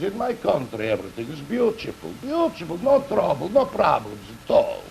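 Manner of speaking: put-on talking dog with a Russian accent; Russian-accented wolfhound voice. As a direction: reassure in a mock Russian accent. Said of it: "And the Russian dog says".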